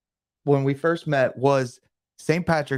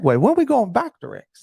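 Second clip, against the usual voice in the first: emphatic repeat; 7 semitones higher than usual. Shown as a demonstration. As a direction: hit it harder the second time. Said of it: "That was my segue".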